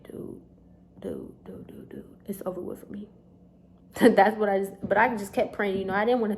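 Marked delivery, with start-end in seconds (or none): 0.0-3.1 whispered repeated dude, giving up; despairing whisper. Retold or imitated. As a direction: whisper it like all hope is gone. Despair when permit shown.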